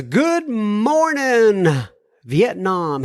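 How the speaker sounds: drawn-out Good Morning Vietnam call; radio DJ shout. Pretend. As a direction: belt it like a radio DJ. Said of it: "He didn't say, "Good morning, Vietnam".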